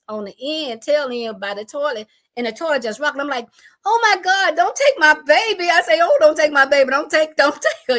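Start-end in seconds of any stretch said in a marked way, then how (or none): 3.9-8.0 loud, high desperate plea; loud frantic plea for the baby in the shaking bathroom; frantic prayer. Retold or imitated. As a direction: beg God loudly not to take the baby. Praying during tornado.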